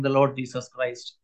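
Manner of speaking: ordinary talk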